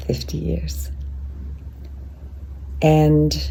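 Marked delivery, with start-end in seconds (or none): 0.1-0.9 whisper. Real, not acted. Emotional close to husband.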